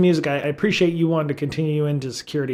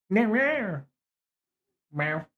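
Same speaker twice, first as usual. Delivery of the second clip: higher mock meows; cat meow; 7 semitones higher, slower than usual. Real, not acted. Imitating the cat.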